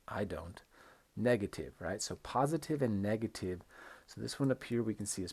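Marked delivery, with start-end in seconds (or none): 0.1-0.6 "I don't" lower and softer, falling. Shown as a demonstration. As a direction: let "I don't" sink low and quiet. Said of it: "It goes down".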